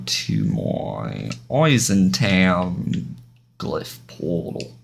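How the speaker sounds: put-on slow drawled muddle of Dutch, German, Bronx and British accents; weird mixed accent. Streamer heading to a game portal, putting on a mixed-up accent. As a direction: stretch it out in an unplaceable mashed-up accent. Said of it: "I don't know what accent that was".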